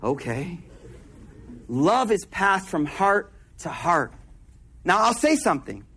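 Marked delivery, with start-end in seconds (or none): 0.0-0.6 much softer, lower, a stunned little "Oh, yo... okay"; awed hush. Retold or imitated. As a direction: murmur it like you're quietly taken aback. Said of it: "You're like".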